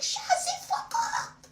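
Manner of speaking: very high, drawn-out mock French accent; put-on French accent. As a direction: squeal it in a comic French accent. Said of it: "I still had the accent".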